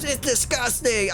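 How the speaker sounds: mock scream. Bullied girl reacting to her soiled clothes, mock scream. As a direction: squeal it as a disgusted girl. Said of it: "and she screams".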